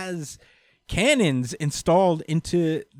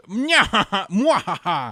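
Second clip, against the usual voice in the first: high mock evil cackle; hammed-up mwahaha cackle; villain laugh; 5 semitones higher, 3 dB louder than usual. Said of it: "he says".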